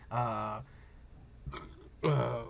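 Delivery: words broken by a burp. Real, not acted. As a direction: burp through it after a big swig. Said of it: "I'm burping here".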